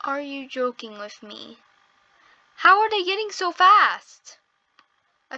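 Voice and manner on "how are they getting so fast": high, loud exclamation of disbelief; incredulous. Real, not acted. Player watching rivals pull ahead, incredulous. Losing a race in the game.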